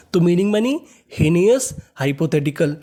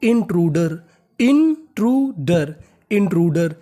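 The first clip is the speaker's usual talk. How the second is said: Intruder drawn out slowly; slow, careful demo; 2 semitones lower, slower than usual. Shown as a demonstration. Teacher slowly pronounces intruder for students. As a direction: stretch out intruder for learners. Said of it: "तो meaning".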